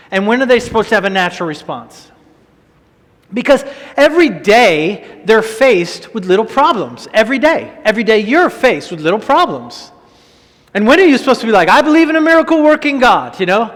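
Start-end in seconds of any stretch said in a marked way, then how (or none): 3.3-13.4 full-force preaching. Acted. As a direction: drive the command home to the church. Preaching rise on daily faith.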